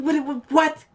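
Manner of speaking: high, rising disbelief at the critics; incredulous outburst. Real, not acted.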